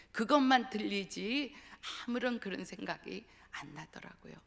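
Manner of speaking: ordinary delivery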